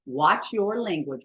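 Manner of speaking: ordinary talk, nothing marked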